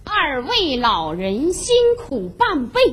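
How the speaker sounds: letter recitation. Acted. Mother reading the children's letter to her suitor, slow recitation. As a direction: stretch each phrase in recited rhythm.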